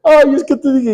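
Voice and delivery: retold tearful lament; mock weeping. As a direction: imitate a friend wailing. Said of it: "iba llorando".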